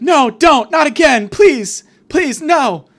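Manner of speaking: high, loud panicked plea; hammed-up daughter's frantic protest; mock desperate begging. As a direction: cry out each phrase in high alarm. Daughter begs father not to go.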